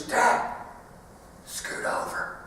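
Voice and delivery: hushed night whisper to dad. Retold or imitated. A scared little boy at the bunk whispering to dad. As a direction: whisper so nobody wakes.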